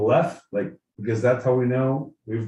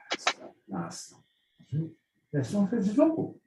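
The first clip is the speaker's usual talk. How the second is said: put-on royal British accent; 5 dB quieter than usual. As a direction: put on a regal British accent, pausing between phrases. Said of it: "in the royal British accent".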